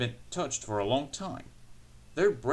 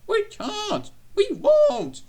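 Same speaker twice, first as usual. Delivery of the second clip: piercingly high, loud wail; screaming trucks; 10 dB louder, much slower than usual. A narrator's reading. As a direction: squeal in mounting panic. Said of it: "they screamed".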